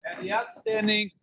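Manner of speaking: normal talking voice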